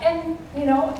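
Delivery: ordinary talk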